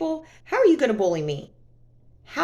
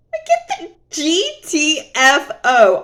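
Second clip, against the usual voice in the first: louder, slow, fed-up emphasis; exasperated; 6 semitones higher, 7 dB louder, slower than usual. Real, not acted. Vlogger ranting about her ex blocking her, slow and loud. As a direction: spell out each letter, outraged.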